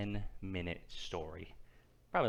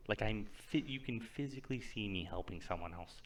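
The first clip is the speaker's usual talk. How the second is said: ordinary delivery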